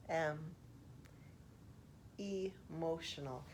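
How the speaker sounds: slow word-by-word poem reading with long pauses. Shown as a demonstration. Reading a poem line aloud to the viewers, slowly paced. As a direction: read it slowly, one word at a time.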